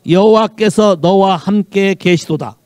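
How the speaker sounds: slow, loud, declaiming the verse; slow booming scripture reading; loud proclamation. Acted. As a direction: proclaim it from the pulpit.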